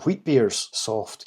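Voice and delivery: ordinary talk, nothing marked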